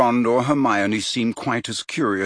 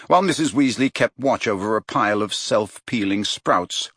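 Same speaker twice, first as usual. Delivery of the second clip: plain reading voice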